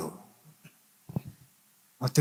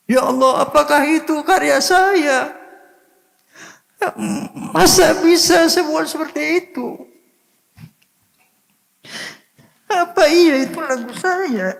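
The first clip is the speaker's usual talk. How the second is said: high, strained cry of disbelief; tearful retelling. Retold or imitated. Man recalls hearing his own songs, tearfully asking God. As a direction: relive weeping in wonder, pitch way up. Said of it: "Saya menangis".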